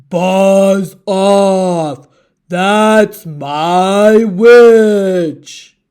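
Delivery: hammed-up monster warning; monster threat. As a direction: drag it out like a looming beast. Horrible beast scares dragon.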